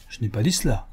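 ordinary delivery, nothing marked

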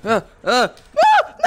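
rising yelps into a scream; panicked yelps. Real, not acted. Gamer starting to slide, panicked yelps and scream. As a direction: yelp twice, then a high loud scream.

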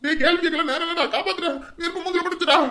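high, loud desperate orders; frantic yelling. Acted. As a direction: yell in pain, begging him to help. Burned man yelling at helper. (வலியில் கத்தும் குரல்)

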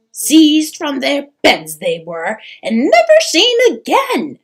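loud, swooping, anguished mother's voice; anxious troll mother, distraught. Acted. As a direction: plead fearfully, rising to a high lament.

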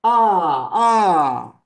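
a firm level ah; Chinese ah. Shown as a demonstration. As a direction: open ah, loud and steady.

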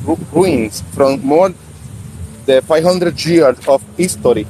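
slow, effortful English; halting slow English. Real, not acted. Host tells viewers about ruins, slow halting English. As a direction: stretch each word out. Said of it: "para los que no sepan mucho español".

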